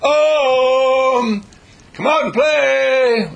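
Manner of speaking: long, loud, high call across the yard; slow sing-song call to come out. Acted. Calling a friend outside.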